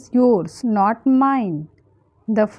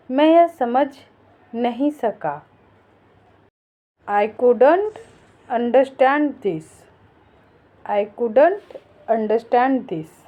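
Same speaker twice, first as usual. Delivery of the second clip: normal talking voice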